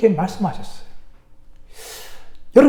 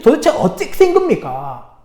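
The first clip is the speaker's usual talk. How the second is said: higher-pitched baffled demand; acted bewilderment, voice pitched up; dumbfounded pastor; 6 semitones higher, 3 dB louder than usual. Pastor confronts the Sunday school teacher, dumbfounded. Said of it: "참 목사님 황당한 거예요".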